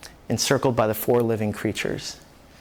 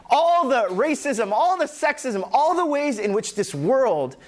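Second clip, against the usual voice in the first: anguished plea; 7 semitones higher than usual. Acted. John's anguished plea voiced.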